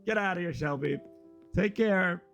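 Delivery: ordinary delivery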